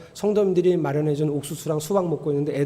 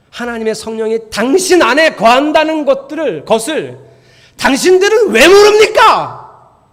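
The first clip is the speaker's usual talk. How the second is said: impassioned scripture reading; 3 semitones higher, 10 dB louder than usual. Shown as a demonstration. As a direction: read it as a thundering, reproachful question. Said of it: "이렇게 읽어야 되는 성경이에요".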